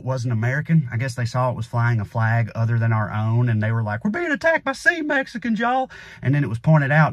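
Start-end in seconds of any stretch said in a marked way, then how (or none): 4.0-5.9 high, hammed-up panicky yokel voice; mock panicked right-winger. Mocking right-wing nuts panicking about the boat, high hammed-up yokel.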